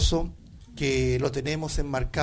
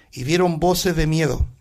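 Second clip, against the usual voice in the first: ordinary delivery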